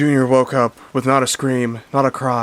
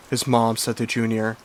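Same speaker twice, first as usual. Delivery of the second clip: plain reading voice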